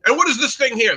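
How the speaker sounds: put-on high, inquiring British officer accent; terrible British accent. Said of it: "I will do my terrible British accent".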